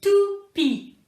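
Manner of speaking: "toupie" said high and drawn out; word said slowly. Shown as a demonstration. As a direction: say "toupie" clearly for a child. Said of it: "Dans le mot". ("toupie" prononcé haut et lentement)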